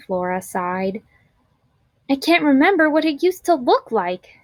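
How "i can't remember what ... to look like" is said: crying out. A narrator's reading. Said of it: "Jamaica cried".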